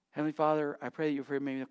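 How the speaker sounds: low, soft mock bedtime prayer; drowsy prayer. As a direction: let the prayer sink, as if dozing off. Sleepy night prayer acted out.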